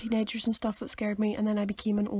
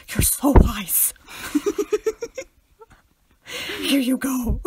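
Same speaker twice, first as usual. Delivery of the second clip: nervous awe; 3 semitones higher, 5 dB louder, much slower than usual. Retold or imitated. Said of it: "talk to an old person like that".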